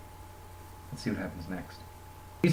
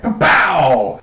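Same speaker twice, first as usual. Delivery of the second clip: mock gunshots; 4 semitones higher, 10 dB louder than usual. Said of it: "He's like".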